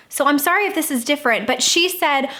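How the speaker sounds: ordinary talk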